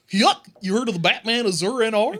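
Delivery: high, loud cartoon mascot voice; Goofy. Theme park Goofy asking about comics, loud cartoon voice. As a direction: yuk it out like a cartoon character. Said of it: "like, Goofy walking like".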